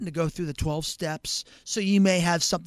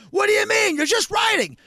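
high, loud outrage; mock exasperated shout; 12 semitones higher, 6 dB louder than usual. Homeowner to writing fireman, high outraged shout. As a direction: yell in disbelief.

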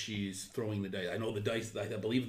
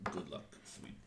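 hushed farewell; 8 semitones lower, 13 dB quieter than usual. Acted. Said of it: "and I just say".

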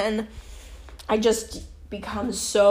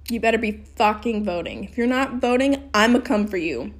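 ordinary delivery

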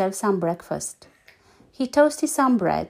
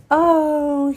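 big sleepy yawn; 6 semitones higher, 5 dB louder than usual. A narrator's reading. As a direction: yawn through it. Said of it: "he yawned".

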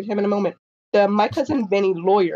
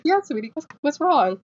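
put-on gentle to a child; 7 semitones higher than usual. To a shy six-year-old girl, higher gentle reassurance. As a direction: coax her gently, sweetie-voice. Answering the little girl kindly.

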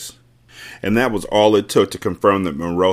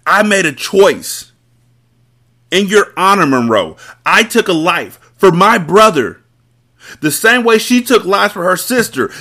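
high, loud, heated plea; loud anguished plea; 10 semitones higher, 5 dB louder than usual. Acted. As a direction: plead and accuse at full voice. Carter defends killing his brother.

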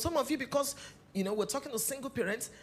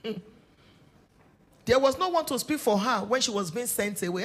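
usual speaking voice

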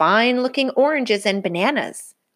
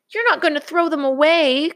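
crying out; 10 semitones higher than usual. Acted. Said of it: "cried Brother".

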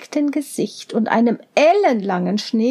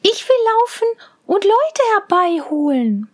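Mädchenstimme; 7 semitones higher than usual. A narrator's reading. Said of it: "sagte Rosenrot".

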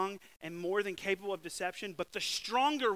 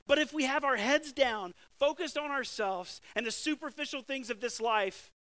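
higher, louder, urgent preaching; fervent preaching; 5 semitones higher, 6 dB louder than usual. Real, not acted. Preacher warning the church about war, fervent and loud. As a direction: drive it home to the church.

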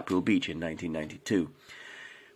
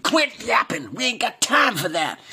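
much higher, loud cowboy shout; rude shout; 14 semitones higher, 4 dB louder than usual. A narrator's reading. Norman interrupts Ethel with a rude, loud American shout. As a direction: cut her off with a rude American yell. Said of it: "interrupted Norman rudely".

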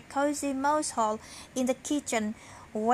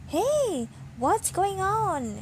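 high, alarmed "hey, what's going on"; startled question; 7 semitones higher, slower than usual. A narrator's reading. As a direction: wake up startled and confused. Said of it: "he asked".

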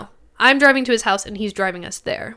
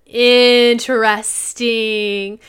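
slow sarcastic; 3 dB louder, much slower than usual. Retold or imitated. Reacting to naked photo.

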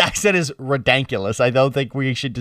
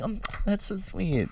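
slow, much softer, higher doting voice; Joanne impression; 5 semitones higher, 13 dB quieter, slower than usual. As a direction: coo it like the ad is adorable. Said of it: "And she's like".

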